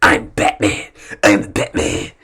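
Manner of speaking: lightly voiced gruff Batman, repeated; a hint of a brooding Batman. Comic imagining Ben Affleck at home, mild Batman growl. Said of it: "I imagine Ben Affleck walking around the house while, while endlessly repeating".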